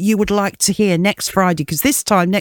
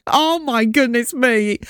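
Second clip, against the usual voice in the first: mock dismay; 3 semitones higher, slower than usual. Real, not acted. Reacting to cringe clip.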